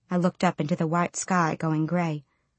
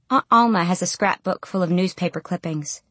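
plain reading voice

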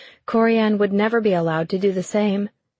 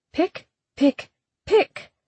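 high, prim pecking words; prim lecturing voice; 10 semitones higher, slower than usual. Acted. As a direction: peck each word out primly. Spoken aloud, then echoed.

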